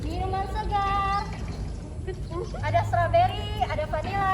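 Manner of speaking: street vendor calling. Acted.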